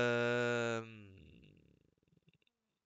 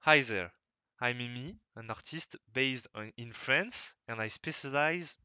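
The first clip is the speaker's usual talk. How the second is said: second, more careful retake of the English self-introduction; careful French accent English. Shown as a demonstration. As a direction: try hard to pronounce the English properly, French accent still heard. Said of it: "faut que je dise bien".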